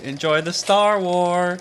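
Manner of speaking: hammy director greeting the audience; drawn-out, slightly high goofy intro; George Lucas. Pretend. Director introducing the movie before it starts, hammy and drawn-out. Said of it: "the director came on before the movie and he was like".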